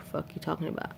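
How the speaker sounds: normal talking voice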